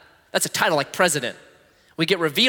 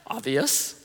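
sarcastic; 4 dB quieter, slower than usual. Retold or imitated. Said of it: "You're like".

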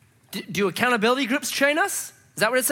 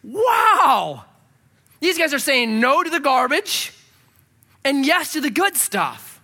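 amazed Barnabas; 6 semitones higher, slower than usual. Retold or imitated. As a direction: gush like a visitor stunned by joy. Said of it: "he's like".

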